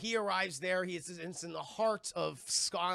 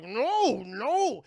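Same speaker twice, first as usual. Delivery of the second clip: loud, drawn-out mock no; distressed cry; 4 semitones higher, 9 dB louder than usual. Kong tries grabbing at them, loud acted cries of protest. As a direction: wail it louder and higher.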